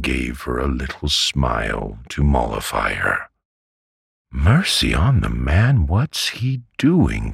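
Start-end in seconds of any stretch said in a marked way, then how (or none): 4.3-7.3 high, swooping exasperated cry; impatient. A narrator's reading. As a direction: cry out impatiently, pitch leaping up. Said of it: "cried my aunt impatiently".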